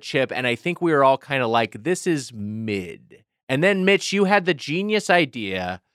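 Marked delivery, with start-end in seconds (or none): 1.8-3.2 slow, quiet, unimpressed verdict; deadpan Gen Z slang; ironic zoomer. Retold or imitated. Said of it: "to sound like a zoomer for a second".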